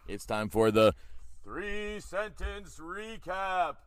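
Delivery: booming drawn-out announcer; big slow game-show announce. Shown as a demonstration. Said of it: "Do you want me to do that again?".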